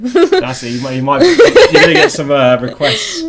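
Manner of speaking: high, loud laughing tease. Real, not acted. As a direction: laugh through the words. Laughing teasing reaction.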